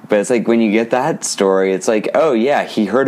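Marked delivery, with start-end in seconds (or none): none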